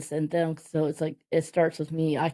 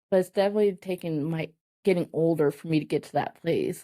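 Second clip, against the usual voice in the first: ordinary talk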